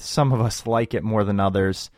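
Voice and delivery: ordinary talk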